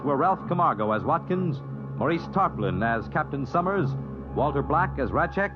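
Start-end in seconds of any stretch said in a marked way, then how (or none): none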